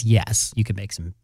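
ordinary talk, nothing marked

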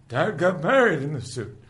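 loud, high-pitched acted cry; excited exclamation. Retelling a sitcom man holding up his dad's blazer, excited exclamation. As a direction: call it out high, as a character amazed by a find. Acting out Jim's discovery in retelling.

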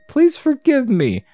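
hammed-up high rodent voice; squeaky rat. The rat apologising.

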